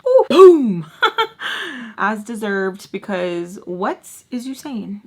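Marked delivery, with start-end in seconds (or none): 0.1-0.9 loud, high whooping boom; triumphant boom. Real, not acted. Teller celebrating her comeback to mom, loud whoop. 0.9-1.9 high, loud burst of laughter; amused laugh. Real, not acted. Teller pleased with her comeback to mom, real laugh.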